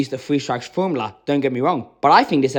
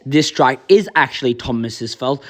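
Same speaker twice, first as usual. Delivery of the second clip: normal talking voice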